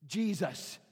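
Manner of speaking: ordinary talk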